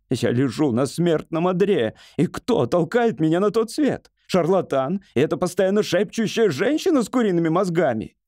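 high, self-pitying tirade; ranting hypochondriac. Acted. Patient on deathbed blames doctor and wife, ranting.

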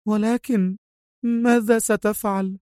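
high, plaintive woman's question; tearful. A narrator's reading. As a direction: ask high, close to tears. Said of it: "غرورقت عيناها بالدموع وهي تسأله".

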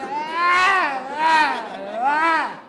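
high yelling moans; loud mock moans. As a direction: cry out in hammed-up ecstasy. Woman moaning in joke.

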